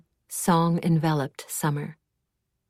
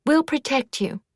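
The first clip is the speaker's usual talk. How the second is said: flustered; 9 semitones higher, 4 dB louder than usual. A narrator's reading. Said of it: "Glad said in a tizzy".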